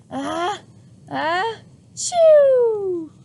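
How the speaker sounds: building up to a huge sneeze. Acted. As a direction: hold it, then blast it out. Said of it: "and then the goat sneezed".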